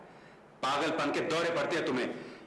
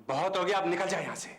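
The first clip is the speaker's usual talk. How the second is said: raised, forceful "बहुत हो गया"; firm angry warning. Acted. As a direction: let the anger out into the voice. Repeated warning, patience gone. (गुस्से में उठी हुई आवाज़)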